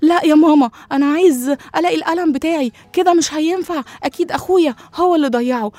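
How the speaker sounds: put-on child yelling at his mother about a lost pen; angry child. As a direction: insist furiously, blaming brother. Mando shouts at mom angrily.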